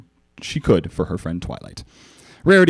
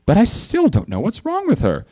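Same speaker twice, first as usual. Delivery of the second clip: higher worried lady; Rarity voice; 12 semitones higher than usual. A narrator's reading. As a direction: fret about her friend.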